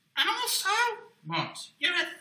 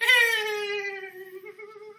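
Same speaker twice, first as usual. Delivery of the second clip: high, loud cackling puppet laughter; hysterical puppet laugh; 12 semitones higher, 4 dB louder than usual. Acted. Puppet bursts out laughing at the host, high hysterical laugh. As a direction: let the puppet crack up helplessly. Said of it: "he's laughing hysterically".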